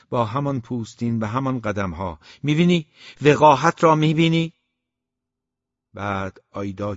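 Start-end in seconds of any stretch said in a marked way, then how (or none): 2.4-4.5 loud, high indignant outburst; angry father. A narrator's reading. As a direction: rage at the son's insolence. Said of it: "پدر عصبانی شده بود". (پدر خشمگین و فریادزن)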